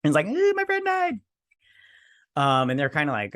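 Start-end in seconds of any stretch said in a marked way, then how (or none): 0.2-1.2 very high, squealed mock lament; hammed-up high-pitched whine of grief; mock wail. Mocking a character's wailing over death.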